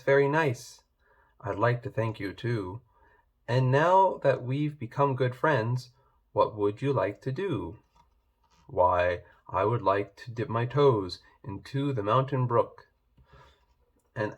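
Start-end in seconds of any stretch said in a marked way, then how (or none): none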